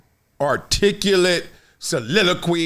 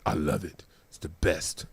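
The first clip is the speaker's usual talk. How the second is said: much lower and much softer contented reply; imitating Mike Tirico; 11 dB quieter than usual. Host imitating Tirico's answer about travel, in a much lower, softer voice. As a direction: drop way down, soft and satisfied.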